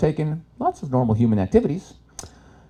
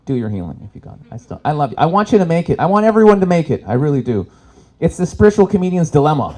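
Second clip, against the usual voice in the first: usual speaking voice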